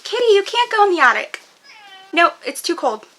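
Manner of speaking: high, loud call to a pet; calling the cat. Real, not acted.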